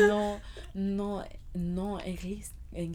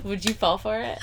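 laughing; 5 semitones higher, 3 dB louder than usual. Real, not acted. Giggling then teasing shout.

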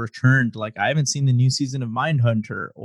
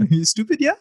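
mock UK rapper; 10 semitones higher than usual. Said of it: "like a U K rapper".

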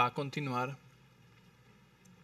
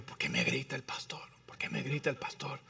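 whispered complaint; 3 semitones lower, 9 dB quieter than usual. Retold or imitated. Offended listener muttering complaint.

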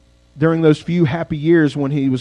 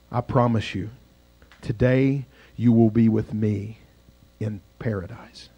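tender promise; 5 semitones lower, 4 dB quieter than usual. A narrator's reading. Jesus answers the dying thief.

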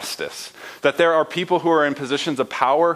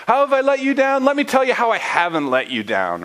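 snarky God; 10 semitones higher, 3 dB louder than usual. Acted. God guilt-tripping his people, snarky and high. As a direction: lay the hurt on thick, mockingly. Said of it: "God getting a little snarky".

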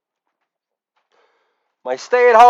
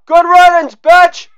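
shouted good riddance; 7 semitones higher, 3 dB louder than usual. Acted. Mock dismissal shouted.

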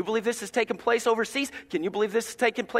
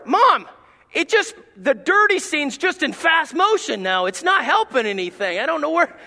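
higher, louder mock whine at mom; a child complaining to his mom; protesting kid; 6 semitones higher, 5 dB louder than usual.

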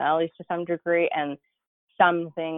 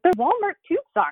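mock incredulous husband; 14 semitones higher, 6 dB louder than usual. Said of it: "He's like".

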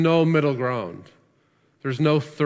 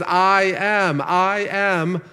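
emphatic proclamation; 5 semitones higher, 3 dB louder, much slower than usual. Acted. Preacher voicing Jesus' claim.

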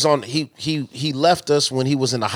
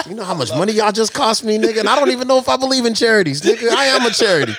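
high-pitched comic outrage; mock indignant; 6 semitones higher, 3 dB louder than usual. Podcaster scolding listeners over money, mock indignant. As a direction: rant in mock offence, pitch up.

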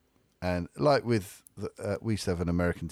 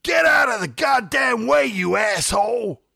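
much higher, much louder American shout out of a car window; imitating an American major screaming at a kid in the road; American major yelling; 14 semitones higher, 8 dB louder, slower than usual. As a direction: bellow it like a furious retired Air Force major. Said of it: "and he goes".

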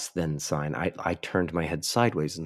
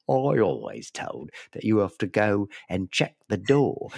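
put-on caller in a broad Australian accent; hammed-up Australian twang; mock Australian accent. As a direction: imitate an Australian guest pleading. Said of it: "Every Australian".

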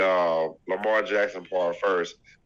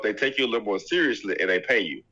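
ordinary delivery

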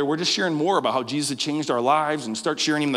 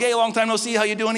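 excited greeting; 7 semitones higher than usual. Retold or imitated. Greeting a friend after weeks.

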